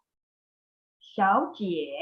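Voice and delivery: 小姐 slowly, 小 rising like a second tone, 姐 dipping; tone sandhi demo. Shown as a demonstration. Teacher to class: slow example word. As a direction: model 小姐 slowly so the rise on 小 is heard. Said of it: "One more example here".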